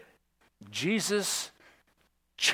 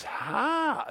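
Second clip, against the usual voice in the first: much higher, a mock gasp of wonder; astonished how; 10 semitones higher than usual. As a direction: let the how rise up in disbelief. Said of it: "see the word forming in her mouth".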